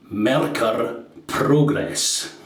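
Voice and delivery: slow, Scottish accent with rolled R in Merkur Progress; Scottish rolled R's. Shown as a demonstration. Naming the razor for viewers, slow Scottish accent with rolled R's. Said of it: "sound good when you roll your R's".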